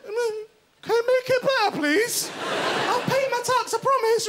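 put-on high, slow, pleading baby voice; hammed-up pleading to the ambulance crew; pleading whine. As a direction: beg like a child promising to be good.

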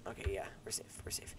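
relieved hushed whisper. Real, not acted. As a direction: breathe out relief softly.

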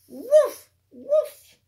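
loud, high playful woofs; dog barks. Acted. Teacher barking for Biscuit the puppy, loud and playful. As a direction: bark like a happy puppy.

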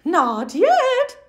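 sunny mother frog greeting; cheery mom voice. Acted. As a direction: greet him like a bright cartoon mom.

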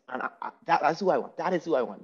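ordinary talk, nothing marked

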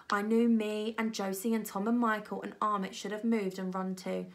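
plain reading voice

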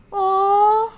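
high, loud aww; cooing aw. Real, not acted. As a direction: coo like at something adorable. The Sims hug after the apology.

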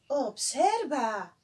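ordinary talk, nothing marked

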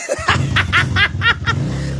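high-pitched burst of laughter; laughing fit. Real, not acted. Streamer cracking up before her suffering act, laughing fit. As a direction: cackle helplessly in quick, high pulses.

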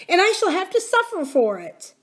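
high-pitched tearful complaint; beginning to cry. A narrator's reading. Nurse fearing punishment, starting to cry. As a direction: lament it as if tears are coming. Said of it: "beginning to cry again".

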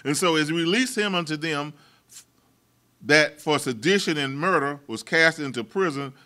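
rising, insistent pulpit voice; fiery preaching. Acted. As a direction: drive the sermon home to the congregation. Preacher builds to full force.

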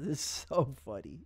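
soft laughter. Real, not acted. Laughing after daughter remark.

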